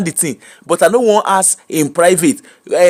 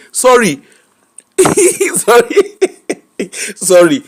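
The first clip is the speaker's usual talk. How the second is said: laughing apology; 4 semitones higher, 3 dB louder, slower than usual. Real, not acted.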